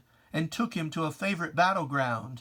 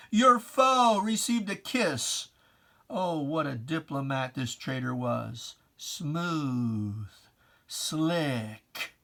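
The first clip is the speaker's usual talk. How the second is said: usual speaking voice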